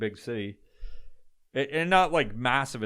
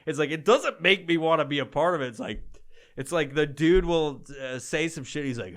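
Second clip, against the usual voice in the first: ordinary talk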